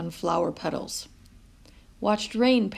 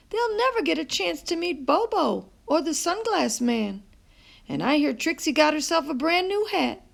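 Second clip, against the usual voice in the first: high, lively Nana coaxing; a touch of a cheerful grandma; grandmother voice; 8 semitones higher than usual. A narrator's reading. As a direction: tempt the boy with fun to come.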